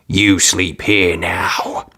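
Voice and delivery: very slow, cold stepmother command; cold order. Acted. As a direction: lay down the law icily.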